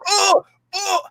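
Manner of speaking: high squealing oh, oh; yelp of pain. Retold or imitated. Mocking the goalie getting hit, high pained yelps. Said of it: "he'd be like".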